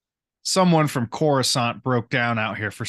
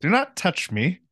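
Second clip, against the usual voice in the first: thin prim disapproving sniff; 7 semitones higher than usual. Retold or imitated. Same prim noble voice.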